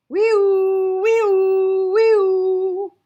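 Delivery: very high, loud wailing siren; siren imitation. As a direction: wail like a fire truck siren.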